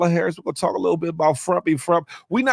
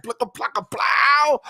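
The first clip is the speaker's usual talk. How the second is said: playful sound effect; 7 semitones higher than usual. Pretend. Man hyping a woman's body, playful sound effect. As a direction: build to a high, stretched "plow".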